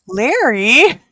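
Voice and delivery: taken aback, call out the name; retold disbelief; incredulous.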